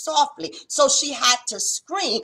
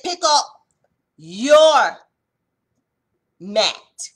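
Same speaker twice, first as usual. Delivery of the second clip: commanding call; 8 dB louder, much slower than usual. Retold or imitated. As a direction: proclaim Jesus's command to the man. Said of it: "he said".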